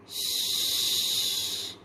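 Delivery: long unvoiced hushing shh; whispered shh. Shown as a demonstration. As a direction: hush like tiptoeing past a sleeping snake. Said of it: "making the sound of".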